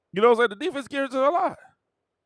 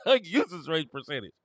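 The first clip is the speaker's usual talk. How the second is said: ordinary talk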